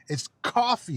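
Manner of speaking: "coffee" pitched high with an open ah vowel; emphatic American pronunciation. Shown as a demonstration. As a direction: punch "coffee" as "cahfee", exasperated. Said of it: "It's not coffee".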